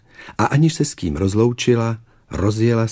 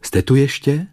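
higher-pitched questioning call; 7 semitones higher, 3 dB louder than usual. A narrator's reading. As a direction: call to check whether anyone is there. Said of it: "zvolala po chvíli".

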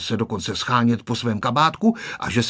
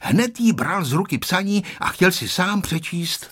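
ordinary delivery, nothing marked

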